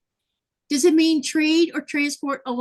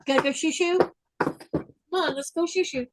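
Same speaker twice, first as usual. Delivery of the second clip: high voice coaxing the dog out; pet talk; 6 semitones higher than usual. Real, not acted. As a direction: coax the dog outside. Talking to the dog.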